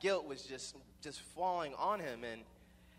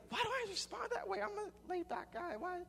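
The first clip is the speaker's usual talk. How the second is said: very high squeaky self-questioning; hammed-up falsetto bewilderment; mock bewildered whine. Stepson baffled by his own outburst, mock high-pitched whine.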